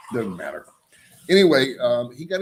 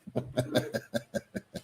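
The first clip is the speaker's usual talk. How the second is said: soft, breathy run of chuckles; 2 semitones higher, 9 dB quieter than usual. Real, not acted. Host joking his guest show will flop, soft chuckle. Laughs at own joke.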